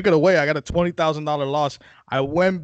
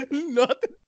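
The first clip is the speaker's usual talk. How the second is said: laughing nothing; 10 semitones higher than usual. Pretend. Repeat through laughter.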